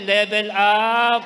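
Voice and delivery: loud, slow "Level up"; call-out. Shown as a demonstration. Preacher models the words for neighbours, loud and slow. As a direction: call out "Level up" for all to repeat.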